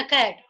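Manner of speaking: plain hard k in "कैट"; everyday Indian pronunciation. Shown as a demonstration. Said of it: "कैट बोलते हैं ना".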